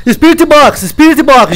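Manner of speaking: high urgent panic; panicked. Real, not acted. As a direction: blurt it out while fleeing. Fleeing the ghost, panicked.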